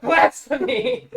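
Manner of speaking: very high, loud, drawn-out shriek; hammed-up scandalized cry; mock outrage. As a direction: shriek it like a horrified zealot. Mock outrage at wearing a dress.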